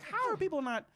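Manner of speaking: high-pitched incredulous question; exasperated, high quoted disbelief; acted disbelief. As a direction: pitch it way up in mock bafflement. Acts out disbelief at the film.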